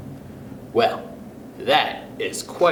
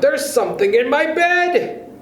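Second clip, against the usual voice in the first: screamed; 12 semitones higher, 3 dB louder than usual. Acted. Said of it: "screamed Missus Twit".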